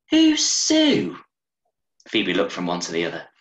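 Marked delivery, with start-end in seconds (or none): 0.1-1.2 put-on high, loud child's question; little girl asking about the stranger; curious little girl. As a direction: ask it like a nosy kid. Child Phoebe voiced in story.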